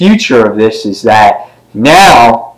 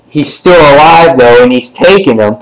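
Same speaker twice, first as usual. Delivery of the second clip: normal talking voice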